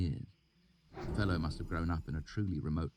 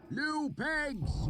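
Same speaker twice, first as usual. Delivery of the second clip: slow, high call to pigs; a herdsman calling far through trees; distant calling; much slower than usual. A narrator's reading. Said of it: "A voice came through the trees".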